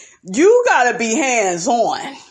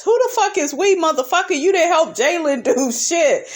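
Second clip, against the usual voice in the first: incredulous outburst; 8 semitones higher than usual. Real, not acted. Vlogger snapping back at Marceau on screen, incredulous and high. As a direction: pitch jumps high in disbelief.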